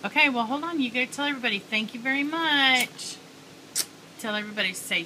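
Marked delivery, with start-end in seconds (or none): 1.6-3.2 slow modelling. Shown as a demonstration. Mom modelling words for child.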